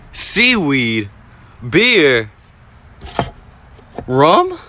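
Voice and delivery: high, loud, amazed listing; excited listing. Real, not acted. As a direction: call out each find with rising excitement. Finds surprising items in home.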